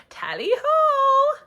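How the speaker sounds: high, slow, loud tally-ho; hearty cry. Pretend. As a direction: belt it out with gusto. Wizard exclaims farewell.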